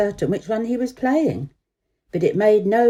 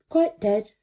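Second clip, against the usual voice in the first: ordinary delivery, nothing marked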